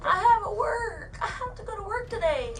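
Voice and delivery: crying and pleading not to be arrested; begging through tears; tearful begging. Real, not acted. Shoplifter begging officer, crying.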